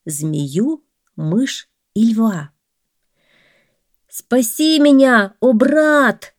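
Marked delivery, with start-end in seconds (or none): 4.1-6.3 high, loud desperate plea; man in the well pleading for rescue; begging man. A narrator's reading. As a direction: cry up from the bottom of the well. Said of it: "взмолился человек".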